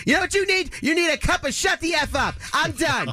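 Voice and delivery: high, mock whiny complaining voice, rising to a shout; imitating the president's high exasperated rant; mocking shrill board president. [name] mimics the board president snapping at him, high and whiny.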